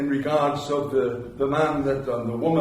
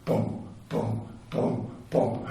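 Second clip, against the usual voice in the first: imitated pump thuds; 6 semitones lower, much slower than usual.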